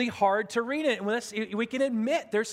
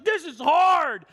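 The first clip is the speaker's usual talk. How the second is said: higher, louder, slow mopey whine; mock moping; 6 semitones higher, 6 dB louder, slower than usual. Pastor imitating Jim moping while sick and preparing. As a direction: drag it out like a sulking friend. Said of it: "moping like crazy".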